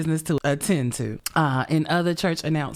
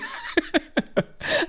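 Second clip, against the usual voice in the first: breathy tearful laugh; 13 semitones higher than usual. Real, not acted. Tearful joy over monetization.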